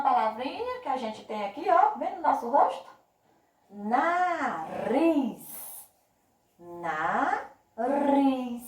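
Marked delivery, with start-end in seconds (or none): 3.7-5.5 "Nariz" drawn out, RIZ emphasized; stressed final syllable. Shown as a demonstration. Teacher quizzing pupils on syllables, elongated "Nariz" with stressed RIZ. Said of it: "que eu falei com mais força". 6.6-8.6 "Nariz" split by a pause, RIZ forceful; stressed final syllable. Shown as a demonstration. Teacher repeating for pupils, broken-up "Nariz" with stressed RIZ. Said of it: "que eu falei com mais força".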